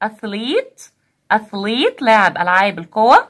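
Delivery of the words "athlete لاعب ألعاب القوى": ordinary delivery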